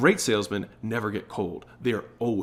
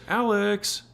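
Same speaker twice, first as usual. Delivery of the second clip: higher, drawn-out "Alex"; drawn-out name call; 9 semitones higher than usual. Shown as a demonstration. Wife calling her husband by name, drawn out and high. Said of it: "my wife, calls me and she says".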